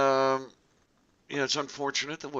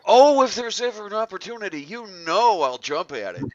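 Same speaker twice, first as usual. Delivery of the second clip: eager; 7 semitones higher, 7 dB louder than usual. Real, not acted. Eager reply about opportunities.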